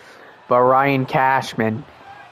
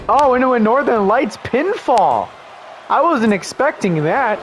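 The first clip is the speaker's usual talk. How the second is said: gasping surprise at the move; surprised; 8 semitones higher than usual. Real, not acted. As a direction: pitch jumps high, caught off guard. Surprise Northern Lights pinfall.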